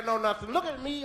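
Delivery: ordinary talk, nothing marked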